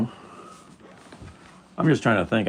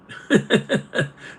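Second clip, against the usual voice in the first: a bursting airy laugh, pitched way up; high breathy laugh; 3 dB louder than usual. Real, not acted. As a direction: laugh out loud at your own punchline. Laughs at own joke.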